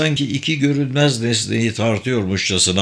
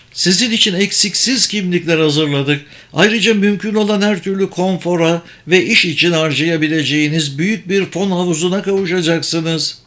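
higher-pitched, assured mage; ikna edici büyücü; 5 semitones higher than usual. Acted. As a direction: sell the offer firmly in a higher voice. Sabır lays out terms. (tiz perdeden, ikna edici büyücü sesi)

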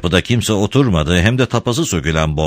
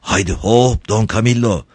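higher, cool-headed urging; 4 semitones higher than usual. A narrator's reading. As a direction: urge him to heave, keeping composure. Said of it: "Soğukkanlı bir ses duyuldu". (soğukkanlı bir sesle)

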